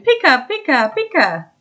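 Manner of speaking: high Pikachu cry blurted out as a tic; a high blurted Pikachu call. Retold or imitated. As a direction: imitate the uncontrollable Pikachu tic. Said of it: "I started going".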